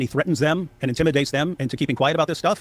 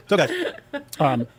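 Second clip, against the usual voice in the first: ordinary delivery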